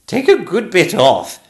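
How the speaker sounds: high, loud regal order; a queen giving a brisk command at full volume. Acted. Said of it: "said the Queen".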